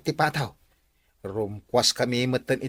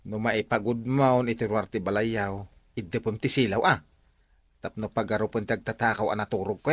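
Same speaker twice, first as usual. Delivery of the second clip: hushed plotting; 7 semitones lower, 5 dB quieter than usual. Acted. Hushed plotting against the thief.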